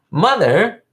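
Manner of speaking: put-on high-pitched questioning outburst; Ricky. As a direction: call out high, as a quoted protest. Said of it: "And then Ricky says".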